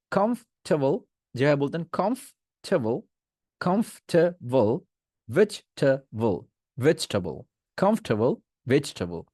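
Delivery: কমফোর্টেবল as kumf-tuh-vul, ভেজিটেবল to match; comfortable and vegetable alike. Shown as a demonstration. Teacher pairing কমফোর্টেবল and ভেজিটেবল, same ending. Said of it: "similar sound".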